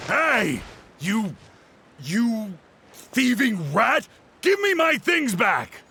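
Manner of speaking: shouting after a thief who stole his book; angry shout at thief. Acted. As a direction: call the thief out, furious. Chasing the thief, calling out.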